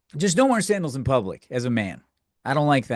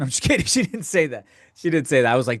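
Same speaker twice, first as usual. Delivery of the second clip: ordinary talk, nothing marked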